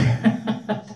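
high giggle at a naughty joke; laughing. Real, not acted. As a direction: burst into a high chuckle. (cười rúc rích)

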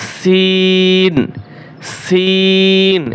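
সিন held very long and thin; drawn-out letter. Shown as a demonstration. Teacher drilling students on a long, thin সিন. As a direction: pull সিন out slowly, lightly. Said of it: "এটাও তিন আলিফ টান হবে।".

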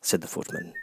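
ordinary delivery

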